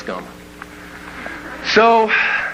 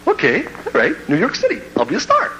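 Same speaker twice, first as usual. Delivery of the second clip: put-on starry-eyed kid; 7 semitones higher than usual. Naive kid dreaming of stardom.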